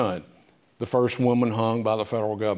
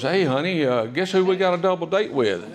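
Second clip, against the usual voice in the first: higher, lightly sheepish husband; Grant to his wife; 6 semitones higher than usual. Retold or imitated. Grant tells his wife about the double date, lightly voiced. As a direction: break the news gingerly. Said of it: "he goes".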